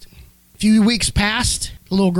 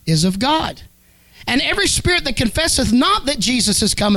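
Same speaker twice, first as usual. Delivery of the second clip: raised, ringing reading of scripture; 4 semitones higher than usual. Acted. Preacher reads First John to his church, raised ringing reading. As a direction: proclaim the verse to the church.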